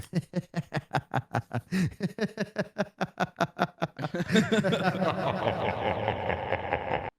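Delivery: long mock evil cackle, high then descending; staccato pretend-villain laughter running out of breath; gloating villain laugh. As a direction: draw out a hammed-up mastermind laugh. Villain gloating that they have fallen.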